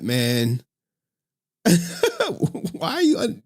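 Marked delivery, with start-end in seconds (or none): 1.6-3.4 high, laughing question; incredulous, dragged out through a laugh; laughing disbelief. Real, not acted. Laughing at an unsent picture.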